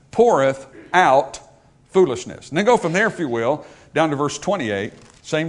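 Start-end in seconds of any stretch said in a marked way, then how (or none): 0.1-2.5 "poureth out" stretched slowly, pause before "foolishness"; stress on POURETH OUT. Shown as a demonstration. Preacher highlighting the first two words, "poureth out" slow and emphatic. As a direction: draw out "poureth out" firmly, then set "foolishness" apart. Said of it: "notice the first two words".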